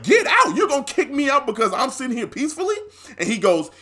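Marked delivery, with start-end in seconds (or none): 0.0-2.7 high-pitched retold disbelief; incredulous comeback. Customer answering threat to be kicked out, high and incredulous. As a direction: throw it back as a disbelieving question.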